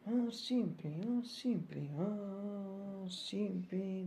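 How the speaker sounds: high, soft, stretched-out phrase; high-pitched singsong, held long at the end; playful high repetition. Acted. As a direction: lift it high and softly draw out the last one. Playful repeats of phrase, last one stretched.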